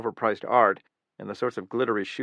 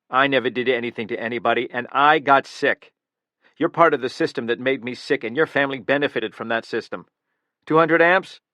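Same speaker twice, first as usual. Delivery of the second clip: bitter accusation; 3 semitones higher, 6 dB louder than usual. Acted. Killer to the guard, loud menacing accusation. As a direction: turn the grievance into a threat.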